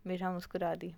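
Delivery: ordinary delivery, nothing marked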